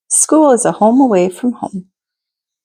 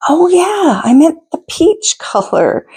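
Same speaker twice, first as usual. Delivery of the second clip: put-on higher, sheepish child's voice; a kid correcting herself, pitched up; friend catching on; 7 semitones higher than usual. Reader voicing the friend realizing her mistake, higher voice.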